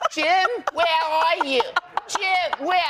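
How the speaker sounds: a lost mother calling for her son; mom calling out. Retold or imitated. Mom interrupting the wedding to find Jim, calling out high. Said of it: "And my mom".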